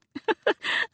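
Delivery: high bright burst of laughter. Acted. Duchess laughing at her nephew's accusation. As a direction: let a delighted laugh burst out. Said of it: "The Duchess burst out laughing".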